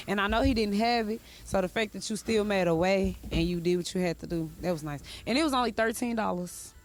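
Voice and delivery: usual speaking voice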